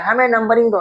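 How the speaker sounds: nitrogen's shrieky demand, pitched high; high little voice insisting. Retold or imitated. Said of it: "nitrogen चिल्लाए".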